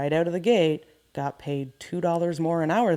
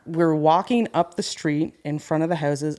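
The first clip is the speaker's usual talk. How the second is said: ordinary delivery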